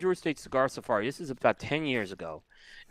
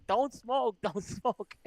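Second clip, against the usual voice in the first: mock scolding; 6 semitones higher, 4 dB quieter, much slower than usual. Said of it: "hearing Jose tell people not to smoke".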